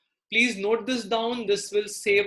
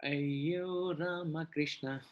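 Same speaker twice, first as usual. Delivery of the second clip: weary sigh; 4 semitones lower, 11 dB quieter, much slower than usual. Real, not acted. Sighing exclamation to self.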